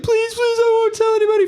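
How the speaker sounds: very high, frantic imitation of begging; mock screamed pleading. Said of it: "they're all screaming".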